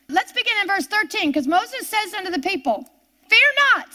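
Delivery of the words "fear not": loud, high command; a bold call of Fear not; commanding cry. Acted. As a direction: call it out to calm a crowd. Preacher quoting Moses' command.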